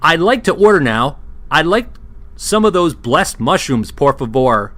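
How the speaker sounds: high, loud ordering voice. Acted. Ordering mushrooms from the waitress Alice, high and loud.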